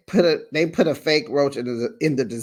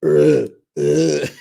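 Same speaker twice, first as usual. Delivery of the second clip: loud imitated double heave; mock retching; 6 dB louder than usual. Vlogger showing both women throwing up, mock retching. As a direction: gag twice as if throwing up. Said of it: "you're gonna throw up too".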